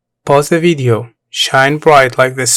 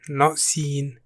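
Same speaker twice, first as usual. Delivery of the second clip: normal talking voice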